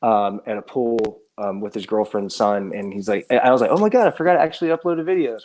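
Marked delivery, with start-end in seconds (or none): 3.7-5.4 higher-pitched retold "oh my God"; mock realisation. Speaker recalls forgetting old uploads, mock realisation. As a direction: replay a sudden remembering, lightly. Quotes own sudden realisation.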